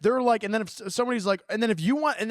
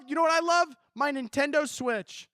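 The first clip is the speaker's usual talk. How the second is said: ordinary delivery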